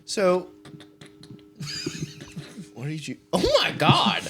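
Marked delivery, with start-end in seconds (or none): 1.6-4.3 very high, laughing question; laughing disbelief. Real, not acted. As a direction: squeal it through laughter. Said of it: "friend stuffs pizza in".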